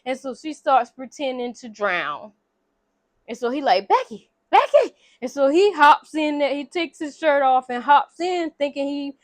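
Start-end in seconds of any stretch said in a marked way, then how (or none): 3.9-4.9 high, loud retold cry of a name; alarmed calling out. Man calling to girl pretending to drown, alarmed shout. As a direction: call out sharply as if someone is drowning.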